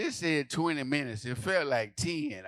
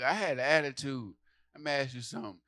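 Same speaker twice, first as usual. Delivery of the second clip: ordinary talk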